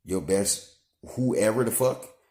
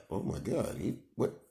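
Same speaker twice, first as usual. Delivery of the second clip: mock dismay; 6 semitones lower, 11 dB quieter than usual. Voicing son's shocked look.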